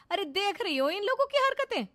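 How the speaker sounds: daughter-in-law yelling about the family, high and loud; shouting. Acted. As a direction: scream her grievance at everyone. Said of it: "चिल्ला चिल्ला कर बात करने लगती है".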